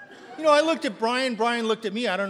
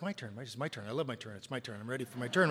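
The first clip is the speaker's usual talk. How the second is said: low, hushed self-talk, lower in pitch and softer; eager muttering to himself; 7 semitones lower, 6 dB quieter than usual. Retold or imitated. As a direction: quietly talk yourself into it, like a kid waiting his turn. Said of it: "I'm like".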